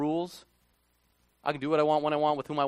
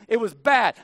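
It was bad said louder, bad hit hard; emphatic repeat; 5 semitones higher, 8 dB louder than usual. Shown as a demonstration. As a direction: punch It was bad loudly to drive it home. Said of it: "repeated for emphasis".

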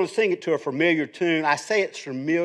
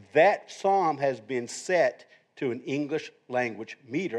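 usual speaking voice

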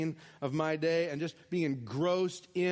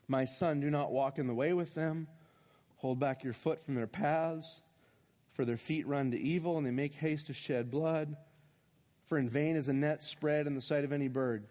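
scripture reading; 6 semitones lower than usual. Acted. Reads Proverbs low.

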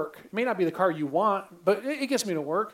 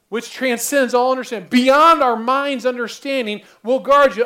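building preaching shout; 3 semitones higher, 8 dB louder than usual. Acted. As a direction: proclaim it louder and louder to the hall. Preacher re-quotes verse, building.

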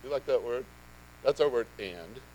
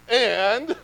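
excited; 6 semitones higher than usual. Acted. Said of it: "and it gets me excited".

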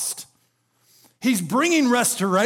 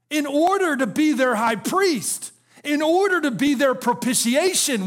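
raised, driving preacher cadence; fervent preaching; 7 semitones higher than usual. Acted. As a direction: build the list with rising fervor. Preaching rising to full force.

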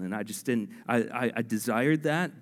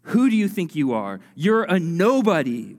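loud accusing shout from the enemy; Satan screaming; 5 semitones higher, 6 dB louder than usual. Pretend. Satan screaming at a believer stepping out: loud sneer. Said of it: "what Satan will scream at you".

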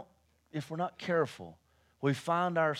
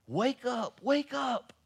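ordinary delivery

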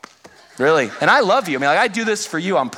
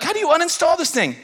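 high, urgent alarmed question; panicked dad; 12 semitones higher than usual. Retold or imitated. Dad alarmed at his daughter's phone. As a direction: ask it in a rising panic. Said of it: "So I'm like".

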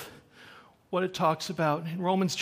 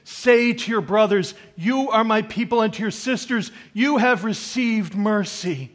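loud, raised-pitch sermon delivery; forceful preaching; 7 semitones higher, 10 dB louder than usual. Real, not acted. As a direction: preach it out in emphatic bursts with pauses.

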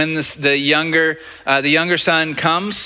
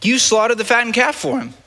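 high indignant complaint; 9 semitones higher than usual. Retold or imitated. Older son complaining to father.

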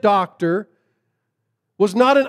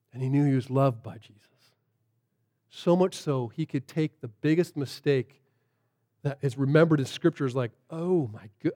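tender quiet preaching; 6 semitones lower, 7 dB quieter than usual. Acted. Preacher assuring listeners of grace, hushed and tender. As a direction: speak grace gently to the room.

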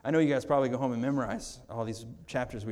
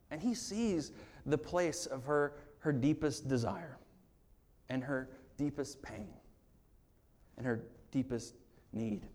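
quiet earnest preaching; tender; 6 dB quieter than usual. Real, not acted. Tender climax of sermon.

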